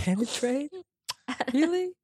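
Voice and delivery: high, disbelieving questions; incredulous. Real, not acted. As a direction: sound shocked at the word. Incredulous at the wording.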